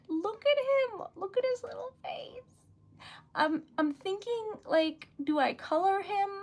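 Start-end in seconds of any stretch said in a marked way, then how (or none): 0.1-1.1 much higher, gushing over something cute; cutesy coo. Real, not acted. 1.2-2.4 high whispered coo over a little face. Real, not acted. Gushing over the cute bear.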